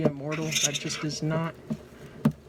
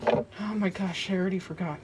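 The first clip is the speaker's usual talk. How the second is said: normal talking voice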